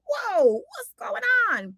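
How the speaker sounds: high, drawn-out whoa of alarm; slow, high-pitched mock astonishment; mock startled. Wisp sees things coming at her, startled high voice.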